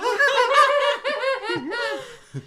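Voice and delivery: high, wild shrieking laugh; clown cackle. Pretend. Clown transformation bit.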